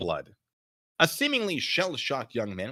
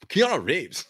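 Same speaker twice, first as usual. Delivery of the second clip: Keanu Reeves; 8 semitones higher, 6 dB louder, slower than usual. Acted. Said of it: "from California".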